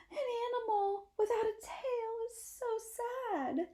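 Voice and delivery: high theatrical wail over a lost tail. Acted. Drama-loving possum wails to her siblings, high and tearful. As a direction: ham up the tragedy like a stage diva. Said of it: "as she wails".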